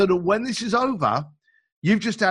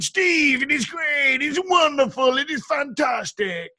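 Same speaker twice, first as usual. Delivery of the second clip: put-on Ukrainian accent; 9 semitones higher, slower than usual. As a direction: imitate the Ukrainian client shouting with joy in his accent. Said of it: "excuse me for the accent".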